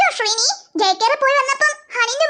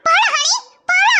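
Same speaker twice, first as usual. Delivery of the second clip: ordinary talk, nothing marked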